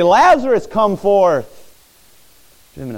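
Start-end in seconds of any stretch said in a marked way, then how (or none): 0.0-1.5 loud, high, authoritative call; ringing command. Shown as a demonstration. Preacher voicing Jesus calling Lazarus from the tomb, loud ringing command. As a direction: ring it out across the hillside. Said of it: "for this voice to ring authoritatively across this hillside".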